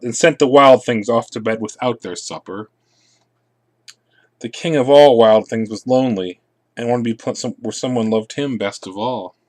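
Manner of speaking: usual speaking voice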